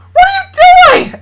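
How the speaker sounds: very high, loud, drawn-out scream; shrieked protest. Acted. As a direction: shriek in outraged disbelief. Luna protests as dad drags Charlie.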